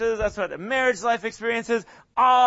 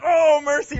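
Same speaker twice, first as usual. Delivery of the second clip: louder and higher, an over-the-top exclamation; mock exasperated cry; 8 semitones higher, 7 dB louder than usual. Complaining church women crying out for help, hammed-up exasperation. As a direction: throw up your hands in playful exasperation. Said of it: "and they're like".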